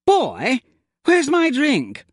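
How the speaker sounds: high, slow, affronted call for a drink; a pampered guest missing his cocktail, high and indignant. A narrator's reading. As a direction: demand your drink, put out and surprised. Pip calls for his drink.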